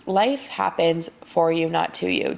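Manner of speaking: "life happens for you" said very slowly with weight, then "not to you"; slow, deliberate emphasis on "for you" against "not to you"; emphasized phrase. Shown as a demonstration. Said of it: "I really wanna emphasize this".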